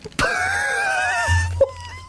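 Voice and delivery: long, very high squeal of laughter; high-pitched laughing squeal. Real, not acted. Host hearing colleague's bad Geordie, shrieking laugh. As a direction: squeal helplessly at an awful attempt. Host reacts to awful accent attempt.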